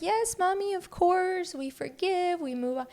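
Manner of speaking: put-on high, sweet little-kid voice; sing-song child agreeing. Mother imitates her oldest son agreeing sweetly, high child voice. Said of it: "my oldest, was like".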